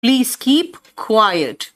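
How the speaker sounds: very slow, careful English; slow model sentence. Shown as a demonstration. Teacher showing students a phrase, very slow. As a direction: lay each word out for learners. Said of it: "चुप रहो".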